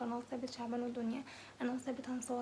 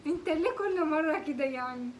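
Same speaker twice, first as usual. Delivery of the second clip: laughing; 3 semitones higher, 8 dB louder, slower than usual. Real, not acted. Caught, laughing complaint.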